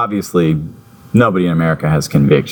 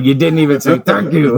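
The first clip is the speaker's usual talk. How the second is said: offended composer voice; 8 semitones higher than usual. Retold or imitated. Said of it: "Howard Shore's like".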